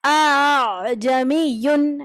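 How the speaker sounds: اَأْعْجَمِيٌّ with the first hamza firm and the second softened, slow; slow Quranic recitation demo; tasheel recitation. Shown as a demonstration. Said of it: "سختی کے ساتھ اور دوسرا والا ملا کے".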